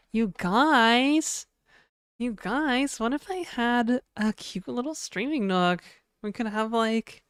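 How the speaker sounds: put-on high, cutesy streamer girl voice, strained. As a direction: push up into a cute e-girl pitch. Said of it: "contorting my, like, vocal cords to reach that, like, pitch".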